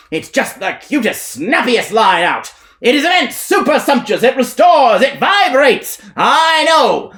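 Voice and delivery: high, loud evangelist hollering at the crowd; revivalist preacher. Acted. Elijah harangues the whorehouse crowd like a preacher. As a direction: bark like a tent-show preacher selling salvation.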